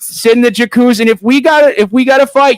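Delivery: high Canadian buddy voice; Canadian accent, tough-buddy bravado. Pretend. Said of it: "talking like this all the time".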